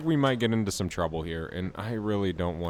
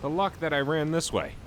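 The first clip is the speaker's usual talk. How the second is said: excited; 7 semitones higher than usual. Real, not acted. Lucky find of rare loot.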